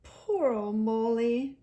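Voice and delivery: slow, kind comforting words; slow tender sympathy; kindly. A narrator's reading. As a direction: soothe a hurt friend. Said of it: "said the rat kindly".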